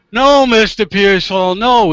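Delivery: retold outburst, emphatic on each "no"; exasperated judge. Judge losing patience with lawyer, loudly exasperated.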